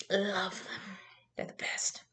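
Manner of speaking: soft, breath-only whisper; whispered aside. Real, not acted. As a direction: whisper fondly about a favourite food. Aside while nursing.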